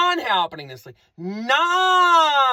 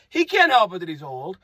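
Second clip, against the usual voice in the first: ordinary talk, nothing marked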